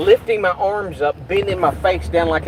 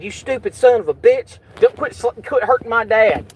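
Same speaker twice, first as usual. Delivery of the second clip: higher, mock angry yell; retold angry yell; 7 semitones higher than usual. Retelling the son yelling at the cop: mock angry yell. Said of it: "he said".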